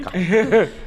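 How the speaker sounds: put-on quick South African accent, laughing. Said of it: "Where's the other accents?".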